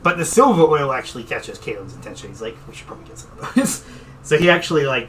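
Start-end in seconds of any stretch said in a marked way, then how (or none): 2.7-3.8 quick, whispered character aside; hushed aside. Acted. Caitlin noticing the silver oil, quick hushed whisper.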